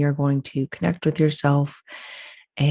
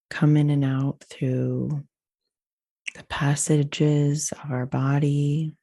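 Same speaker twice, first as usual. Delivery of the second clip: slow breathing cues with long pauses; guided meditation. Acted. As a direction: lead them unhurriedly through each breath.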